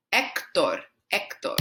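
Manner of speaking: Hector with silent H, EK-tor; Spanish pronunciation. Shown as a demonstration. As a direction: drop the H in Hector. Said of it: "our H is silent".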